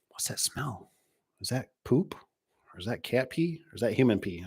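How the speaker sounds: soft, puzzled sniffing questions; suspicious murmur. Retold or imitated. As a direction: mutter like a detective. Detective sniffing around.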